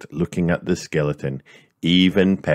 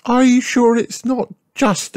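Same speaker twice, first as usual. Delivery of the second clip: high, louder cheerful mum voice; Mummy Pig greeting; 4 dB louder than usual. A narrator's reading. Mummy Pig greets George, high and louder. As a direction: greet the little boy as his mother. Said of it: "Mummy Pig asked".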